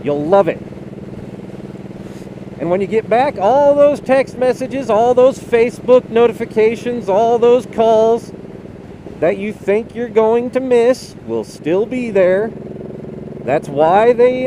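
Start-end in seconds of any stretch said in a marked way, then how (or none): 3.4-8.3 THOSE hit higher and louder each time; stress on THOSE. Real, not acted. Emphatic rhetorical list.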